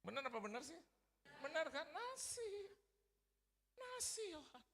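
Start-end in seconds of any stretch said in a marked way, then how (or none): 1.9-4.6 very high mom voice; gentle mother. Acted. Mother answering her son simply, high gentle voice. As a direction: pipe the word up high. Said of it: "Mama saya dengan lembut bilang".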